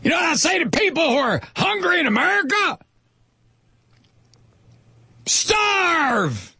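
high, fast shouted preacher voice; mock preacher shout. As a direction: roar it like a televangelist. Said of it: "Pastor Hagee".

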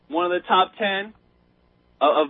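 normal talking voice

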